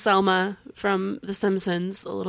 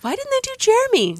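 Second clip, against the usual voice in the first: puzzled; 5 dB louder than usual. Retold or imitated. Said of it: "and I was like".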